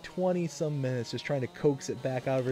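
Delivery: ordinary delivery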